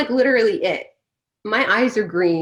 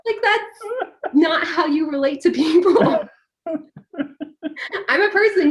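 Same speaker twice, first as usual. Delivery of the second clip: laughing; 8 semitones higher, 3 dB louder than usual. Real, not acted. Laughing at absurd encounter.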